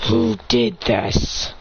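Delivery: very low, very slow growl; deep, drawn-out threatening question; menacing growl. Acted. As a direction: growl it with cold fury. Finds teacher dead, demands culprit.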